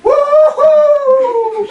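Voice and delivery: very high, loud woo-hoo; triumphant whoop. Retold or imitated. Sister running off with the frog, triumphant whoop. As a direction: celebrate the catch. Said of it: "She said".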